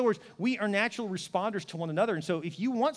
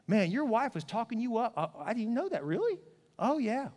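mock surprised chatter; 3 semitones higher, 5 dB quieter than usual. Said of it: "She'd be like".

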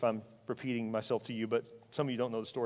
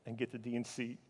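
ordinary talk, nothing marked

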